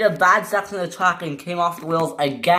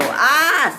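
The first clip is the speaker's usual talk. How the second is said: short high-pitched "ah" scream; 10 semitones higher, 4 dB louder than usual. Acted. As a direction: yelp "ah" in alarm. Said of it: "screamed Hugo".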